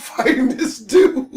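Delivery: ordinary delivery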